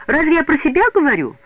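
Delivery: high, loud indignant question. A narrator's reading. Лида defends herself before the group, indignant. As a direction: protest it with the pitch shooting up. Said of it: "возмутилась Лида".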